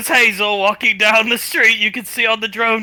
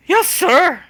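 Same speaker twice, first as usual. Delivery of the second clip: much higher, loud chirpy reply; eager yes; 10 semitones higher, 5 dB louder than usual. Acted. Little girl accepting the job from Tommy, eager and chirpy. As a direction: snap to attention happily.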